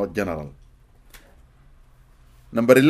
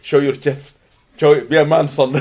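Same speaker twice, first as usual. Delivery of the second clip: loud, hammed-up egging-on; mock pep talk, loud and amused; uncles pumping him up; 3 semitones higher, 10 dB louder than usual. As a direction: play the uncles cheering on the groom. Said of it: "His uncles are pumping him up".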